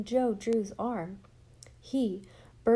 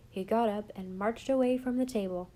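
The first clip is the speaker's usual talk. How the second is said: usual speaking voice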